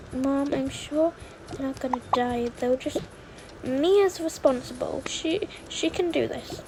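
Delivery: usual speaking voice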